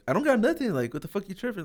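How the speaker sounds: high-pitched mock protest; indignant denial. Retold answer to a suspicious cop, indignant. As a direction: protest innocence, pitch jumping up.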